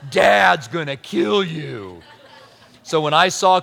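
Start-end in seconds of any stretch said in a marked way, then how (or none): 0.1-2.0 mock ominous warning. Repeats brother's warning.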